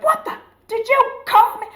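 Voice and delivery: very high, loud, outraged screech; outraged outburst. Retold or imitated. Retelling her reaction to being insulted by her date, outraged. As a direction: explode in hammed-up disbelief. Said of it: "I came up out of my skin so fucking fast".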